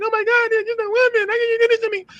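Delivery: very high falsetto whine; mock someone playing helpless victim; mocking whiny woman. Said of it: "Now you're gonna be a woman".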